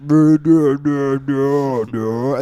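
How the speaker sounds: dopey, dragged out duhs; slowed-down dumb voice. Retold or imitated. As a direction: imitate a slowed-down song. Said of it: "slow person".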